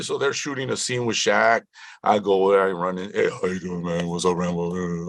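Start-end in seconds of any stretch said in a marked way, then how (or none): 3.1-5.1 lightly mimicked big-man mumble; a hint of a famous athlete's lazy greeting. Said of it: "You know, that voice".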